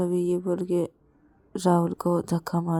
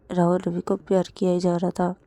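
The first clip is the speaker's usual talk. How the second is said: ordinary talk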